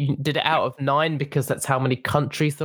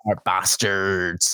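slow, low mock Scottish accent; a Scottish accent that drifts toward pirate; 6 semitones lower, slower than usual. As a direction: drawl it out in a put-on Scottish brogue. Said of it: "I like to do it in that Scottish voice".

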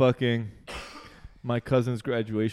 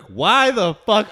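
high, loud, drawn-out demand; hammed-up furious relatives; mock outrage; 10 semitones higher, 9 dB louder, slower than usual. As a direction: stretch it out in appalled disbelief.

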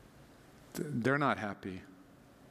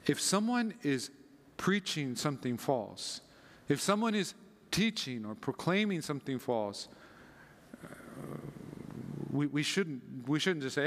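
ordinary delivery

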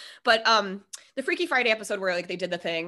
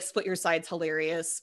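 normal talking voice

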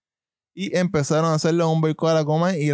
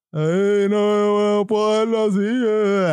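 mock whimper, pitched higher; hammed-up sobbing politician voice, higher; whiny crybaby; 4 semitones higher, slower than usual. Mocking the mayor's tearful concession, whiny and higher. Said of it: "Estaba lloriqueando, estaba llorón".

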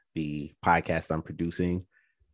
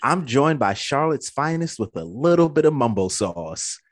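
ringmaster; 5 semitones higher, 6 dB louder than usual. Acted. Announcing guests.

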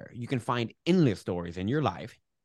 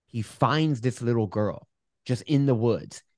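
ordinary delivery

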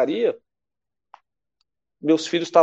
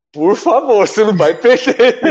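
higher, louder pleading through laughter; son's louder, higher laughing plea to his father; 7 semitones higher, 5 dB louder than usual. Retold or imitated. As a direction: beg dad not to lose, half laughing. Son pleading on the phone, retold.

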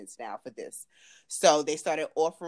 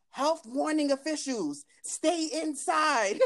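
higher, slow loudspeaker call; megaphone announcement; 11 semitones higher, slower than usual. Retold or imitated. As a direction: imitate a police loudspeaker. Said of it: "all I hear is the N Y P D".

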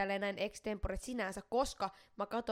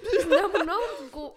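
high, loud laughing speech, slowed; real laughter breaking the words; 9 semitones higher, 11 dB louder, slower than usual. Real, not acted. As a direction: talk straight through a burst of laughter. Laughing at her own laugh.

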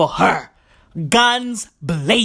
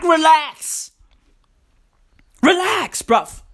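raised-pitch telling-off; exasperated urging; 5 semitones higher, slower than usual. Real, not acted. Telling pushy companies to relax, exasperated. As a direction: tell them to back off, pitch jumping up.

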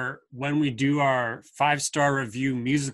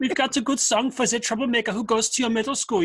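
lightly hammed German accent, pitched higher; mock German accent; 8 semitones higher than usual. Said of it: "an appropriate accent".

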